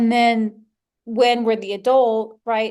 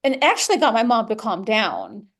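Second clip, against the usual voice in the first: ordinary talk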